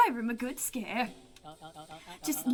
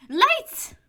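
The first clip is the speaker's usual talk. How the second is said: high, loud startled cry; surprised yelp; 11 semitones higher, 10 dB louder than usual. Acted. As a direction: caught off guard in the dark. Player reacts to dark.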